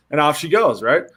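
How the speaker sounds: normal talking voice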